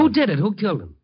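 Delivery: sharp high, pushing questions; angry demand. Acted. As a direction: demand the killer's name in anger. Reporter demands the killer's name.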